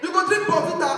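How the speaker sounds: high, loud insisting mother; insistent mama. Retold or imitated. Mama pressing Bonvita on her son, high and loud. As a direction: push the drink loudly.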